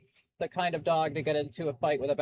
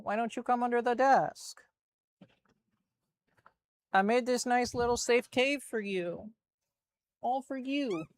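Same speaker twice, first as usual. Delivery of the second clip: higher, sweet coaxing to the dog; coaxing pet voice; 6 semitones higher than usual. Real, not acted. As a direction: lure him gently into the cave. Coaxing dog under desk.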